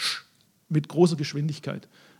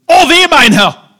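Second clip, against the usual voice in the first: high, loud, slow shrieked alarm; mock scream; 11 semitones higher, 17 dB louder, slower than usual. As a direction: shriek it like a servant bursting in at dawn. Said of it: "hätte geschrien".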